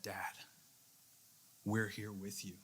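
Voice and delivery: softly imitating a daughter's reassurance; retold gentle comfort. Young daughter comforting her crying dad, retold gently and softly. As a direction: quote the child's comfort quietly, without acting it up.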